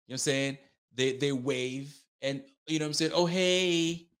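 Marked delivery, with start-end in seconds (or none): 3.1-4.1 mock fiance greeting. Imitating a newly engaged fiance.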